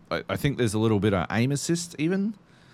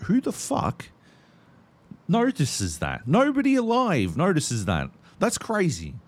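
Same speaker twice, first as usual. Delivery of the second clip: incredulous; 11 semitones higher than usual. Retold or imitated. Imitating his own reaction to wife.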